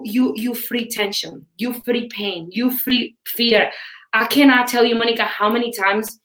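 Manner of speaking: usual speaking voice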